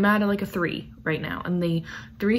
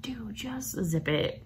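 whispered scolding; 2 semitones higher, 7 dB quieter, slower than usual. Retold or imitated. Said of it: "And I'm like".

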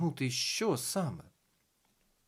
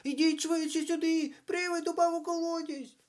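put-on very high, urgent child voice; alarmed children shouting, pitched way up; children calling out; 4 dB louder than usual. A narrator's reading. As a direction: summon help in a high-pitched kid's cry. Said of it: "загукали вони".

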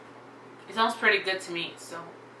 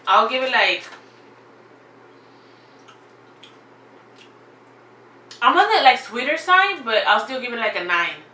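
excited; 4 semitones higher, 7 dB louder than usual. Real, not acted. Excited verdict on the box.

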